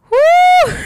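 very high, loud whoo; overwhelmed whoop. Real, not acted. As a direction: let out a piercing, held whoop. Overcome retelling the good news.

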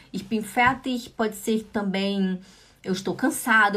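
ordinary delivery

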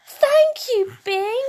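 put-on high, sing-out little-girl thanks; grateful child. A narrator's reading. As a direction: thank a friend in a bright high squeak. Sula thanking Bing happily.

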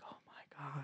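barely audible, awed whisper; stunned whisper. Retold or imitated. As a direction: whisper it to yourself, amazed. Stunned reaction, retold.

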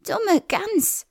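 high scornful witch. A narrator's reading. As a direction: sneer at the silly girl. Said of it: "sagte die Hexe".

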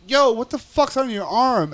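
high-pitched imitation of a startled demand; alarmed challenge. Inmate spotting the Klan tattoo, alarmed challenge. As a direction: call him out on it.

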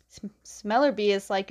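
normal talking voice

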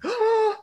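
quick sharp gasp of disbelief; sudden audible intake of shock; mock shocked gasp. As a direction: gasp as if scandalized. Imitating a shocked reaction.

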